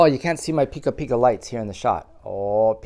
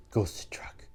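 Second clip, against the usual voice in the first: whispered aside; 4 semitones lower, 12 dB quieter than usual. Real, not acted.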